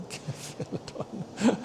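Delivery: cephalodon breathed out through a laugh; laughing at himself. Real, not acted. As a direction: giggle through the word, giving up. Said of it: "I can't say it".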